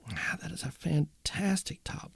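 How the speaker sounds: hushed breathy admiration; awed whisper. Real, not acted. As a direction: whisper it in awe at the wood. Admiring guitar top.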